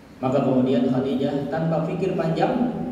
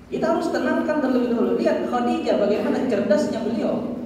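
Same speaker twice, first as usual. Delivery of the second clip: normal talking voice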